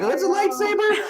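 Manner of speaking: high, laughing mock awe at a lightsaber; naive wide-eyed newbie. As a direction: play the clueless apprentice, giggling.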